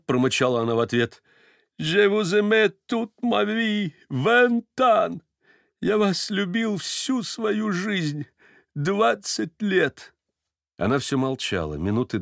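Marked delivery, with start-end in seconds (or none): 1.8-10.1 high, slow heartfelt confession; tearful confession. A narrator's reading. Stepan confesses twenty years of love, heartfelt.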